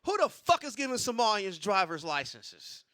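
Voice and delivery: ordinary talk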